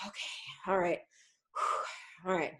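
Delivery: long unvoiced sigh then a whispered okay; breathy letting-go, mild dog voice; relieved dog sigh. Shown as a demonstration. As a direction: let the tension out in a sigh. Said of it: "they just kinda go".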